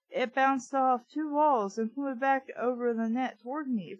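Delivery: usual speaking voice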